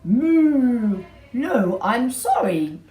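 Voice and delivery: be a slow, friendly cow. Acted. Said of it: "And Mister Cow said".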